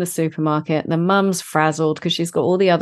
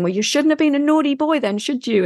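scolding mum; 9 semitones higher than usual. Retold or imitated. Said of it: "And she's saying".

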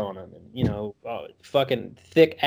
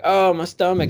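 lightly voiced child with a bellyache; mock complaint, pitched up a bit; whiny little boy; 5 semitones higher than usual. Younger boy complains of a stomachache, lightly voiced whine. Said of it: "saying his stomach hurts".

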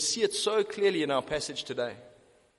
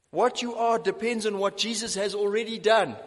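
ordinary talk, nothing marked

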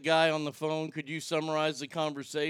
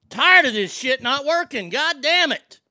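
loud, higher fed-up yelling; real frustrated shouting; angry rant; 8 semitones higher, 9 dB louder than usual. Real, not acted. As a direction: vent at the failing tech. Ranting at equipment not working.

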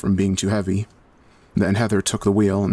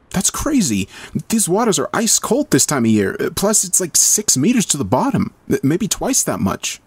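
higher, lightly voiced young woman, uneasy; worried young woman; 9 semitones higher than usual. A narrator's reading. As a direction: voice the narrator's alarmed protest. First-person narrator voices herself.

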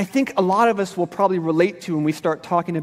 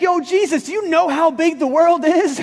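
mock call, higher and a bit louder; yelling up; 8 semitones higher, 4 dB louder than usual. Disciples yelling up at Jesus as he ascends, mock shout. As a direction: playfully shout upward at a rising figure. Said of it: "they're yelling up at him".

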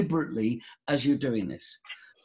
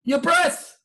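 high mock yell; exasperated voice of nature; 12 semitones higher, 4 dB louder than usual. Nature tells the dummy the answer, high exasperated yell. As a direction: shout the answer as if it were obvious. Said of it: "It says, nature says".

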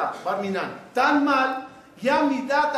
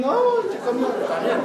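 preacher mock-objecting to parents who are both lenient; mock protest; 2 semitones higher than usual. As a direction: jump up in a different voice to object.

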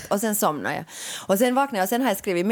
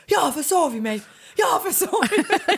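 frantic cry rising higher on the repeat; panicked shout; 7 semitones higher than usual. Retold or imitated. Friend on the phone having overslept, shouting in panic. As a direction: blurt it out in a panic. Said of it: "du var så här".

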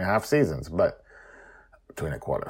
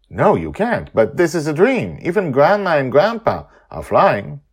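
higher, brighter storyteller voice; character voice; 11 semitones higher, 14 dB louder than usual. Acted. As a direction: voice the character up high. Character quote.

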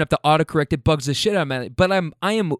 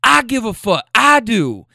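high, loud mock insistence; hammed-up shout back; imitating her yelling; 9 semitones higher, 6 dB louder, slower than usual. Girlfriend insisting to her boyfriend, high mock shout. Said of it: "She's going".

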